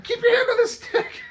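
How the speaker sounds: squeaky, cracking mock pilot voice with a laugh; high laughing mock voice. Friend mocking a movie pilot to a buddy, high laughing put-on voice.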